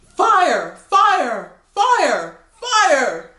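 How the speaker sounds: loud, high repeated alarm cries; hollering. Acted. Rhinoceros hollering fire to provoke a stampede, loud cries. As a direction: bellow it like a panicking beast. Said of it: "a rabid rhinoceros who's constantly hollering".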